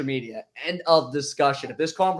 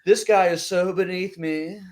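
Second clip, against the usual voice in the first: slow, stuck-up drawl, looking down his nose; snobby customer; 4 semitones higher, slower than usual. Retold or imitated. Salesman mimics snobby customer looking down on him, slow and snooty. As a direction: sneer it like a bougie snob.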